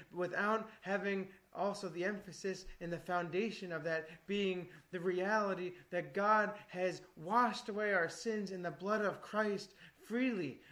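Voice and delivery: ordinary talk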